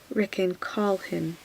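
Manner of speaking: ordinary delivery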